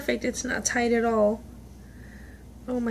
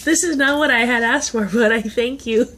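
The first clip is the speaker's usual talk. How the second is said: loud, fast mock-grumble; loud teasing thank-you; loud joking thanks; 7 dB louder than usual. Real, not acted. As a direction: boom it out playfully.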